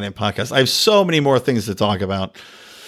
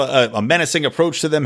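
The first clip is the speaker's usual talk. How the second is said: ordinary talk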